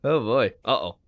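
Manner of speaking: ordinary delivery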